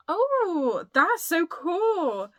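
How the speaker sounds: high, loud, drawn-out delighted cry; thrilled. Real, not acted. Vlogger discovering a gifted book in the parcel, thrilled. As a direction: gush slowly and loudly over the present.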